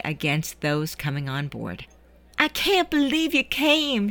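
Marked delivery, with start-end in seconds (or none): 2.4-4.1 higher, delighted exclamation; exclaimed. Acted. Juliet reaching Eddie on the dock, exclaims higher. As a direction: gasp it out in happy disbelief. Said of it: "she exclaimed".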